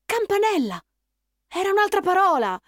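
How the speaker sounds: put-on children shouting out in discovery; excited discovery. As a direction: realise together with joy. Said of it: "dissero in coro".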